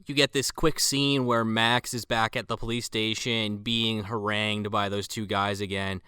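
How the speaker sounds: usual speaking voice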